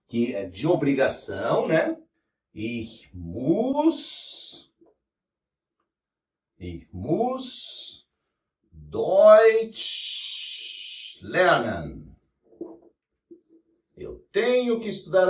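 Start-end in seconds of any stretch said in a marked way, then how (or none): 2.5-12.2 frase quebrada com pausas longas, palavra por palavra; ditado devagar. Shown as a demonstration. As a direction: dite devagar enquanto escreve. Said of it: "Que é o müssen". (lento, com pausas entre as palavras)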